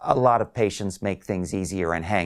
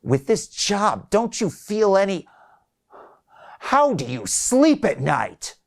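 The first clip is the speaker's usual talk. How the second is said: put-on pitched-up, slow, accusing old man; indignant patient asking how she sleeps, higher and slow; upset old man; 11 semitones higher, 3 dB louder than usual. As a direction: lean in, how could you, slowly. Wiley accusing the caller.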